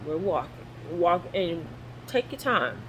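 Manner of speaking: usual speaking voice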